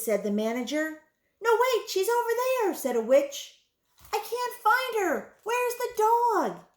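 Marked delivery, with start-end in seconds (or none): 1.4-2.7 fast, high, loud correction; calling out urgently. A narrator's reading. Witch calls out in the chase. 4.1-6.6 high-pitched frantic yell. A narrator's reading. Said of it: "yelled another".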